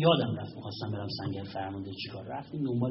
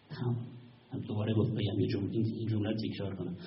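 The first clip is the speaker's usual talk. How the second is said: ordinary talk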